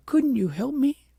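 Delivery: high, loud piteous begging; a little princess pleading for help with all she has; piteous plea. A narrator's reading. Rosamond begs the wise woman for help, piteous and high. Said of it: "said Rosamond piteously".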